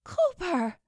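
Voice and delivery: high, startled gasp of Cooper's name; gasping cry of a name. A narrator's reading. Dina sees her friend shot, startled high cry. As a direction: cry out in shock, pitch jumping high. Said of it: "She gasped".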